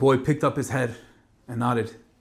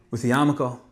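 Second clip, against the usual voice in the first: ordinary delivery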